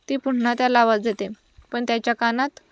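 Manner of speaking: usual speaking voice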